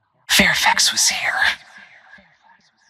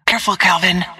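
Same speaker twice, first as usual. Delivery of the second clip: higher, alarmed heads-up; pitched-up cautioning call; urgent warning; 6 semitones higher, faster than usual. Acted. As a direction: warn a teammate right now. Game warning to a teammate.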